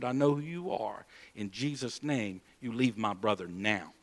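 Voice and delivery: low, soft, firm rebuke; quiet command. Retold or imitated. Sibling casting the devil out of brother, quietly commanding. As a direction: drop low and soft, commanding without raising your voice.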